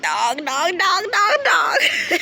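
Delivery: hammed-up disbelief breaking into laughter; exasperated disbelief. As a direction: escalate into a squeaky, laughing plea. Acting out her reaction to friend.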